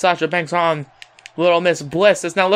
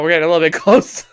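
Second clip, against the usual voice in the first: laughing; 4 semitones lower, faster than usual. Real, not acted. Camera zooms in awkwardly.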